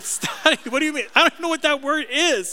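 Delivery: high, incredulous "Study?"; mock disbelief. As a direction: play dumb, high and disbelieving. Mock disbelief at wife's word.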